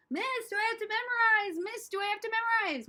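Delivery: high-pitched pleading student; whining high schoolers. Retold or imitated. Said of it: "high schoolers".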